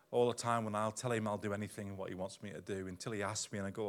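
usual speaking voice

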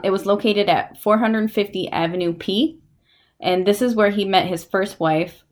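ordinary delivery

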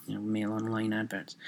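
usual speaking voice

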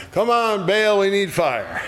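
high-pitched mock holler; imitated hollering. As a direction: call out high, hammed-up. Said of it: "they're hollering".

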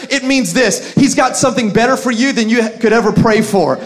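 loud rising preaching; full-force preaching. Acted. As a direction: build the sermon to a peak. Preaching climax.

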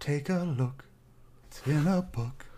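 soft singsong, last word drawn out; slow, gentle, crooned TV host parody; LeVar Burton. Acted. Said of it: "LeVar Burton comes up and goes".

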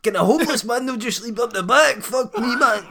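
homeless man shouting. Acted. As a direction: plead loudly for some sleep. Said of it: "quite a good wee skit".

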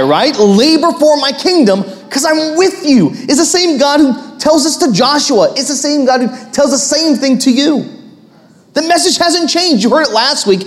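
high, building pulpit proclamation; full-force sermon climax; impassioned preaching. Real, not acted. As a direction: proclaim scripture with mounting fervour.

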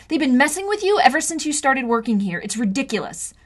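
fed-up sister; angry. Acted. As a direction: let the outrage pour out. Arguing with brother.

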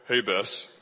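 put-on low manly voice; showing off a new deep voice; newly changed voice. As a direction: drop it deep to impress a girl. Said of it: "my voice started to change".

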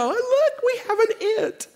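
high, laughing mock delight; mocking quote. Preacher mocking the idea of a genderless baby, high and laughing. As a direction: ham up a silly parent's cheer. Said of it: "We don't go".

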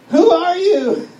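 loud, high, slow yell; acted high, drawn-out shout; calling out. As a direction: holler it down into the fog. Calls back to unseen voice.

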